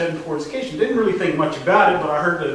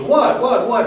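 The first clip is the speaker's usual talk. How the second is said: higher-pitched startled repeated "what"; startled questioning; 6 semitones higher, 4 dB louder, slower than usual. Retold or imitated. Listener reacting to the dishwasher trick, startled questioning. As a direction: imitate a startled, insistent questioner.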